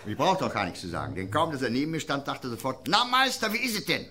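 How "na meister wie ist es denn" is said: mock hearty greeting. Greeting in the local question game.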